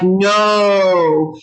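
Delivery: "no" with the O held long. Shown as a demonstration. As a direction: stretch the vowel in "no". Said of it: "Long O".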